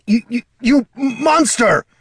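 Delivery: very high, loud, furious outburst; stutter, then explode in accusation; outraged, drawn-out yell; stammering shout. A narrator's reading. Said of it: "shouted Jin".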